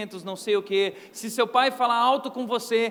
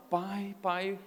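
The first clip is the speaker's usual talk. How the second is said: mock sweet-talking kid; 3 semitones lower, 6 dB quieter, slower than usual. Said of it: "Eu já começava".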